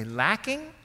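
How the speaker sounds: can't see what's missing; puzzled echo. Pretend. Echoing God's word.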